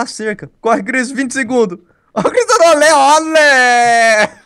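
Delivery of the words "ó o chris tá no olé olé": high loud gleeful shout; excited cheering. Real, not acted. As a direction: cheer him on loudly. Cheering friend's escape. (torcida animada)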